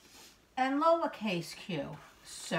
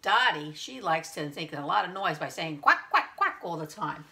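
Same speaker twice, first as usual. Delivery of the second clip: ordinary delivery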